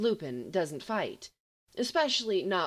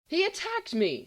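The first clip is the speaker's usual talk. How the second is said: much higher indignant outcry; blustering; 10 semitones higher, 3 dB louder than usual. A narrator's reading. Sirius protesting to the nurse, indignant bluster. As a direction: puff up and bluster. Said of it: "Sirius blusters, puffing".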